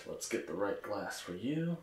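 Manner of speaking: ordinary talk, nothing marked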